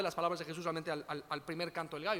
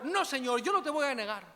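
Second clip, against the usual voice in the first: higher, louder boastful vow; jactancioso; 6 semitones higher, 5 dB louder than usual. Retold or imitated. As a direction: imitate Peter protesting boastfully, pitched up. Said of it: "tres veces se jactó". (jactancioso, imitando a Pedro con voz más aguda)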